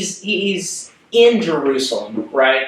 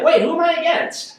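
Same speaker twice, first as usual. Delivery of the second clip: a startled, high "wait, who?"; mock confusion; 14 semitones higher than usual. Leader imagining herself confused by the voice, high and surprised. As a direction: jump way up in pitch, baffled. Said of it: "I'd be like".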